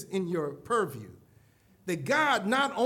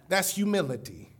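ordinary talk, nothing marked

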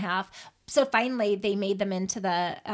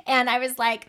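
ordinary delivery

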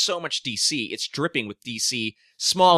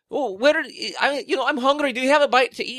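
much higher, silly mocking voice; making fun of the agents on an undercover mission, pitched way up; 12 semitones higher, 4 dB louder, faster than usual. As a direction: ham up the agents whining on a mission. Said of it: "they go".

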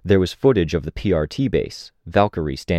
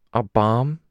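plain reading voice

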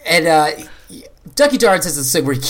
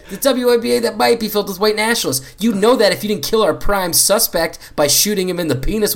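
excited spy boss; 6 semitones higher than usual. Acted. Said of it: "And he says".

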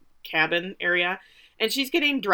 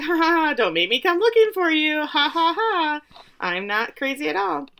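higher, forced cackle-laugh on a cheery threat; manic fake laugh; 8 semitones higher than usual. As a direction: laugh like someone pretending to be fine. Voicing unhinged Misty voicemail.

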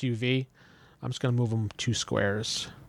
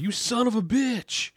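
angry villain; 10 semitones higher, slower than usual. Acted. GM voices angry NPC.

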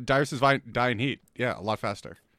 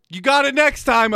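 cheery encouragement; 10 semitones higher, 5 dB louder than usual. Retold or imitated. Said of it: "everyone goes".

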